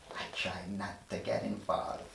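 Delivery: ordinary delivery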